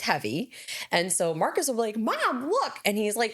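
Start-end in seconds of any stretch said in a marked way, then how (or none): 2.0-2.8 put-on high child voice, Mom look; imitating child calling. Six-year-old calling mom to watch him. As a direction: kid calling out for his mom.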